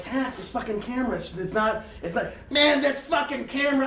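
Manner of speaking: high, rising exasperated yelling; fed up with the broken camera; building angry complaint; mock frustrated rant.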